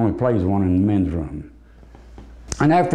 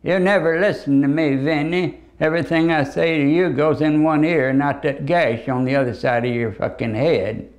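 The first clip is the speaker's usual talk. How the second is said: nagging mother; 5 semitones higher than usual. Retold or imitated. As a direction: scold your son like a fed-up mother. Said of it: "Vincent van Gogh's mother".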